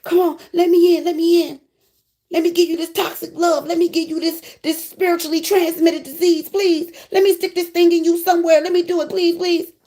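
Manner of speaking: high-pitched mock begging; desperate pleading. Toxic ex begging to be let back in, mock pleading. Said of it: "And here they go".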